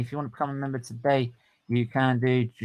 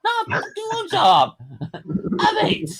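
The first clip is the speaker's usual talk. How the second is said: high, loud defiant yelling; mock fan rage, much higher and louder; defiant shout; 3 dB louder than usual. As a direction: refuse to shut up, at full throat. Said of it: "I said".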